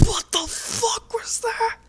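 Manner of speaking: high shrieking panic; shocked shriek. Acted. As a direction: screech it in panic. Jeff reacts to a voice in his head.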